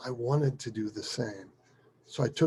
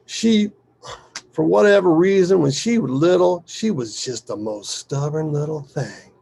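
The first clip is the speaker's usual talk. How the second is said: higher and louder, settling by the end; animated father reminiscing; 5 semitones higher, 9 dB louder than usual. Acted. Father starting a story about his daughter, animated. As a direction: launch into a fond family story.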